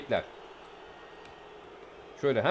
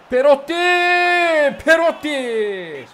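much higher, very loud, drawn-out scream of joy; goal celebration shout; 11 semitones higher, 9 dB louder, much slower than usual. Real, not acted. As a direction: scream the scorer's name as it goes in.

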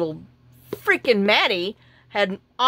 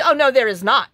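louder, snippy mock of a rude clerk; snooty; 4 semitones higher, 6 dB louder than usual. Mimicking the snooty driver at the counter, loud and curt. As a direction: imitate her curt denial. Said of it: "that's how she's talking to me".

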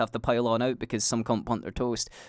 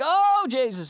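mock alarmed dad; 14 semitones higher, 4 dB louder, slower than usual. Dad runs to the fire.

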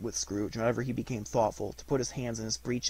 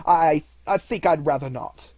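loud, raised stammer of refusal; nervous reluctance; 5 semitones higher, 11 dB louder than usual. A narrator's reading. Scrooge declines the three spirits, nervous and stammering. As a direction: stumble on the first word, then back away.

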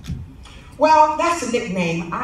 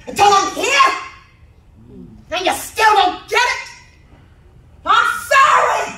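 higher, louder fervent sermon voice; preaching at full force; 10 semitones higher, 5 dB louder than usual. Acted. Eulogist preaching over sister's body, fervent and loud. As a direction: build it like a Sunday preacher.

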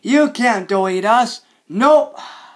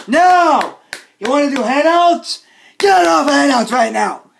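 raised, agitated ranting yell; angry shouting; 3 semitones higher than usual. Acted. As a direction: shout orders at intruders. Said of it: "I'm very angry".

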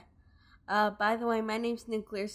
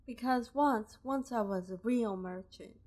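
lightly voiced Southern woman merchant; a hint of Southern drawl for a sad shopkeeper; Southern accent; 2 semitones higher than usual. Robbed merchant explains to the player, light Southern accent. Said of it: "kinda doing the Southern voice thing again".

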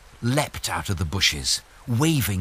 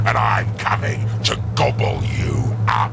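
troll voice. Acted. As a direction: growl it hungrily. Troll threatens.

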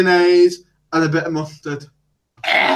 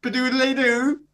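mock posh English; 6 semitones higher than usual. Mocks the posh southern accent.

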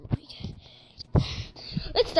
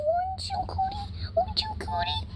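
much higher, much softer, fading to a whisper; soft high cooing; 9 dB quieter than usual. Acted. Cuddling Cootie the cat, soft high coo into a whisper.

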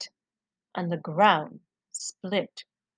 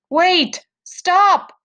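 loud, higher call of alarm; crying out; 9 semitones higher, 6 dB louder than usual. A narrator's reading. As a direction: shout after them to stop. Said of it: "cried the baker woman's husband".

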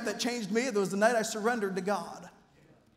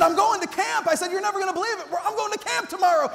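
can't contain the big news; boy shouting his news to mom; excited shout; 7 semitones higher than usual. Retold or imitated.

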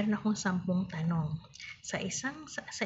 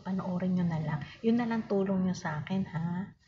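ordinary delivery

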